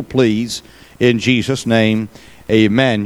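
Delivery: hushed, low prayer voice. Real, not acted. Opening prayer.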